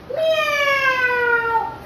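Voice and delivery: long, high-pitched meow; playful high cat cry. Shown as a demonstration. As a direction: draw out a thin kitty meow. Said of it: "slouch your back and say meow".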